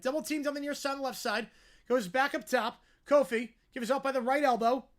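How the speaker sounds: brisk, projected basketball commentary; live play-by-play. Real, not acted. Calling a basketball possession for listeners, live play-by-play. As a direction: follow the ball down the court, punching key words.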